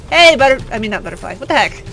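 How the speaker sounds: ordinary delivery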